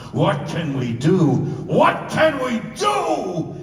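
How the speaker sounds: slow, rising from firm to a full high shout; building to a shout. Retold or imitated. Singer recreating the climax of his song before the orchestra, shouting. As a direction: swell the lyric into a rallying cry. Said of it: "So Blue are the lyrics".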